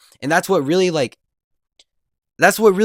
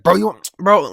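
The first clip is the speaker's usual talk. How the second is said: raised, loud mock challenge; imitated anger; 5 semitones higher, 5 dB louder than usual. Pissed friend steps to classmate again, loud imitated challenge. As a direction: act out the pissed friend squaring up. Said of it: "he was pissed".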